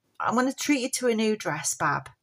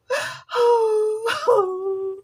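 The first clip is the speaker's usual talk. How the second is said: high puppet sob; 12 semitones higher, 4 dB louder than usual. Pretend.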